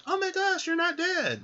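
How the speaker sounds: very high, hammed-up astonished squeal; mock shock. Woman seeing the man alive, mock shocked. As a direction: squeal in pretend disbelief.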